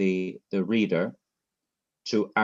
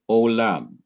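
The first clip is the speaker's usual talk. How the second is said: "olam" with the accent on LAM; final-syllable stress; 5 dB louder than usual. Shown as a demonstration. Teacher introducing another word, "olam" stressed on LAM. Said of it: "the last syllable, ... get the accent".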